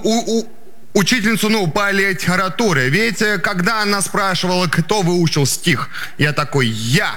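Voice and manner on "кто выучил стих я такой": normal talking voice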